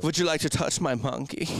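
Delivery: a hint of the Sprockets host's German accent; lightly voiced Mike Myers German. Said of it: "it's like the Sprockets, Mike Myers".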